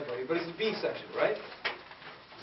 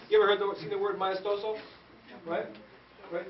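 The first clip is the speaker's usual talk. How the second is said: ordinary delivery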